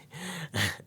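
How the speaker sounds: soft, trailing giggle; amused giggle. Real, not acted. Reader still chuckling at fading the card, soft giggle. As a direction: let the laugh fade out quietly. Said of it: "That's funny".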